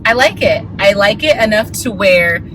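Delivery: ordinary talk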